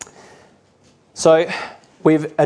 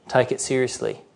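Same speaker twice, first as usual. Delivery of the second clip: ordinary talk, nothing marked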